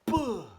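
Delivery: a sharp loud buh, high and punched; loud beatbox buh. Shown as a demonstration. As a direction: pop out a loud buh like a kick drum. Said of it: "And then you just say".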